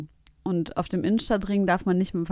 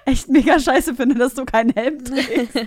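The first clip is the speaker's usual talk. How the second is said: mock scolding; 5 semitones higher, 8 dB louder than usual. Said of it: "dachte mir in dem Moment so".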